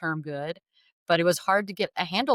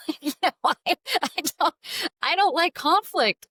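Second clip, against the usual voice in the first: laughing high; 12 semitones higher, 4 dB louder than usual. Real, not acted. Laughing at her own protest.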